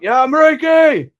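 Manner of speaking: high, slow, drawn-out shout across the mountain; retold yell. Houthi fighter yelling at the brother across the slope, loud high call. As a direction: call out to the enemy fighter as if from far off. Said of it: "the guy was yelling".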